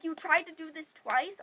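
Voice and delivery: loud disbelieving question; incredulous anger. Retold or imitated. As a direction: demand it loudly, appalled. Incredulous confrontation, retold.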